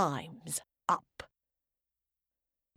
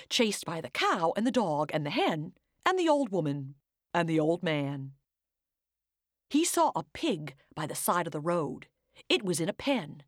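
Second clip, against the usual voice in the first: plain reading voice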